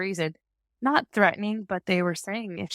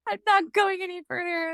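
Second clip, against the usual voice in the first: high-pitched mock refusal; dramatic; 11 semitones higher than usual. Mom reenacting her refusal in labor, mock-dramatic. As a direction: dig in, hammed-up and high. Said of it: "I was so dramatic".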